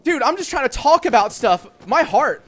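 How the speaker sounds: high, loud shaken shouting; panicked yelling. Real, not acted. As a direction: yell it, heart still racing. Still panicking after jump scare.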